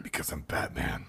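low gravelly Batman whisper. Retold or imitated. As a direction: growl it like Batman. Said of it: "Batman quote, lower".

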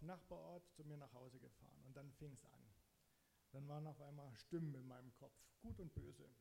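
ordinary delivery

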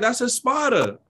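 high retold quote, spider as spah-da; imitated pronunciation. Repeating what she said when asked again, imitated pronunciation. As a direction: pitch it up and say spider as spah-duh. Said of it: "she was saying it different".

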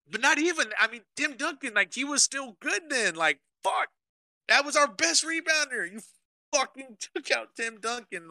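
high, exasperated sports-fan rant. Real, not acted. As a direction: vent like a fan who can't believe it. Ranting about Pop benching Duncan.

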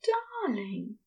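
high, slow and tender, with a sigh in it; a fond angel, slow and sighing; sighing darling. Acted. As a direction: sigh the endearment lovingly. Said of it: "he sighs".

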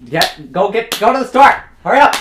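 high loud yelling; shouted orders. Acted. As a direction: yell at them to hurry. Ordering partner to store.

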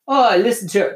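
bad Russian accent. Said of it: "This is a really bad Russian accent".

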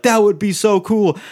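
high, eager mock-fan voice; excited fan gush. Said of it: "Everybody's like".

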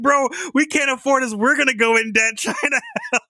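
high-pitched scammer, cracking up; squeaky, laughter-strained retelling; laughing mimicry. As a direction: imitate panic through giggles.

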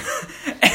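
airy giggling burst; breathless laugh. Real, not acted. Remembering the nurse, giggling helplessly. Laughing at own Shrek quote.

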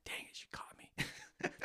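slow, laughing whisper of being caught; guilty whisper. Retold or imitated. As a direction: breathe it out like a busted dad. Imagined guilty thought, whispered.

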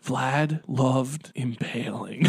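low, slow, breathy deadpan. Retold or imitated. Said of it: "He talked in a low and really breathy way".

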